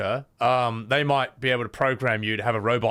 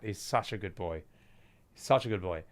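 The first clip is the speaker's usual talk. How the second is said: cooing; 6 semitones lower, 8 dB quieter than usual. Real, not acted. Cooing over the cat.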